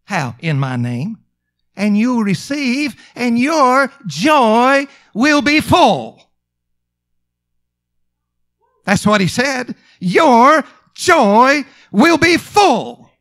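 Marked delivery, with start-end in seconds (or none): 1.8-13.1 slow, high, ringing declaration; drawn-out, emphatic sermon delivery; preaching at full force. Real, not acted. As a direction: proclaim each word as a promise.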